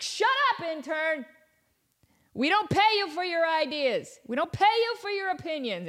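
high-pitched mock outrage; hammed-up angry boss; bosses snapping at intern. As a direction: scold an underling in a high, indignant voice.

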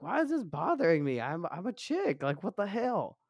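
high, baffled self-questioning. Retold or imitated. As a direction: wonder aloud, confused and annoyed. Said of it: "and I was like".

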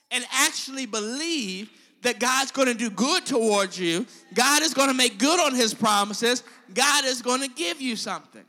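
loud preaching. Acted. Preacher promising the congregation, loud. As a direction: proclaim it to the room, loud.

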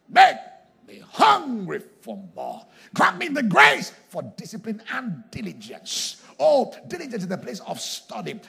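loud, impassioned shouted prayer; pleading at full force, slow and building; fervent prayer. Real, not acted.

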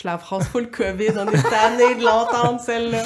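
laughing. Real, not acted. Mocking the covid phrase.

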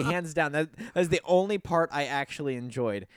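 ordinary talk, nothing marked

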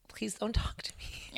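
a scared little whisper; nervous whisper. Retold or imitated. Comic to hecklers, a timid whisper. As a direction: beg them off under your breath. Her old reply to hecklers.

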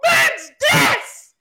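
very high, slow drawn-out scream. Pretend. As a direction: scream it, stretched long. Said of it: "Who wanna do it first?".

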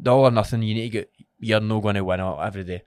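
ordinary talk

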